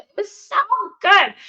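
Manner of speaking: high, loud rapture on good; gushing. Real, not acted. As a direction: swoon over how good it felt. Gushing about morning journaling.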